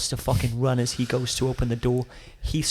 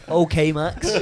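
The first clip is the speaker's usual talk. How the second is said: "Okay, Max" squeezed out through real laughter, higher; laughing disbelief; 5 semitones higher, 4 dB louder, slower than usual. Real, not acted. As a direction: laugh off his story. Laughing at Max's explanation.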